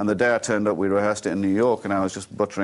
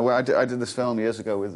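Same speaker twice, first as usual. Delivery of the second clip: ordinary delivery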